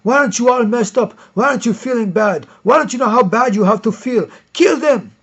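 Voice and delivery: high, loud mocking zealot rant; shrill caricature of a commie zealot. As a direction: ham up a frantic true believer. Mocking communist zealots, acted rant.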